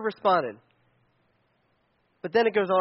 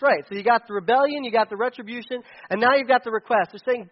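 usual speaking voice

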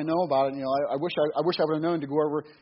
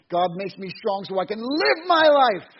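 slow, loud, higher defiant villain declaration; proud villain; 7 semitones higher, 10 dB louder, slower than usual. Retold or imitated. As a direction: boom it out like a defiant movie villain. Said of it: "Malekith's response is".

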